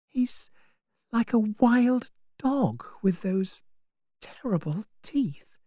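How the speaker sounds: high, hushed horror sinking into a whisper; frightened private thought, high and breathy; inner thought. A narrator's reading. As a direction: think it aloud softly, whispering the last words. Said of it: "she thought".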